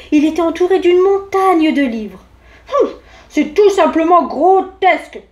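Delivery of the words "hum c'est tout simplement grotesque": scoffing big-voice bear, louder and higher; big-voiced bear. A narrator's reading. Bear scoffing at a book, big indignant voice. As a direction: declare it in a big voice, with a huff. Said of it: "de sa grosse voix".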